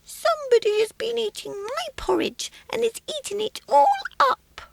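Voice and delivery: very high squeak, rising to a loud squeal; a baby bear wailing about his porridge; small squeaky baby voice. Acted. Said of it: "small, squeaky baby voice".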